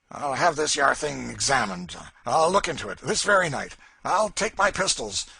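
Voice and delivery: a hint of a bully talking big; blustering Legree. A narrator's reading. Legree vows to search the garret, blustering bravado.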